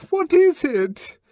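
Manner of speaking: frightened woman nearly screaming at the man shaking her. Acted. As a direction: let the question break into a scream. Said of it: "she almost screamed".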